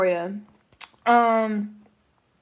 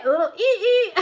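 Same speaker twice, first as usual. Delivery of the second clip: high giggly squeal into a laugh; 8 semitones higher than usual. Real, not acted. Teasing a friend, squealing with laughter.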